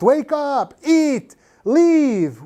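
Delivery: high-pitched, forceful one-word orders; commanding. Shown as a demonstration. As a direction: call out each command high, with a falling end. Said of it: "This is 명령문".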